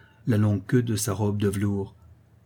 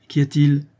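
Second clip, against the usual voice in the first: sharp demand; 5 semitones higher, 6 dB louder than usual. Acted. Countess demands what happens.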